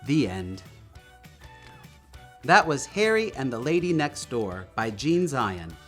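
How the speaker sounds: plain reading voice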